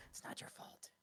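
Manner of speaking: hushed, private aside in character. Acted. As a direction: whisper it under the breath. Consoling line, voiced character.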